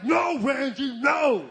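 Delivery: put-on loud, emphatic girlfriend's no; shouted refusal. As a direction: shut him down loudly.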